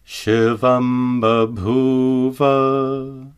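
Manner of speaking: slow chanted Shivam babhuva with labial ma; Sanskrit example. Shown as a demonstration. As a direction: chant Shivam babhuva slowly, m closing the lips. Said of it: "For example".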